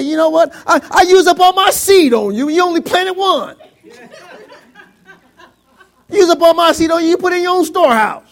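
loud, high mock tirade; grudging scolding. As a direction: scold them at full volume, hammed up. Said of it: "I didn't give it to you grudgingly".

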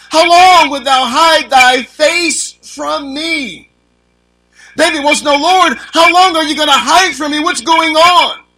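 high, loud and slow, stretching every word; preaching at full force. Acted. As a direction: plead it like a man begging to be seen. Preacher reads and voices David.

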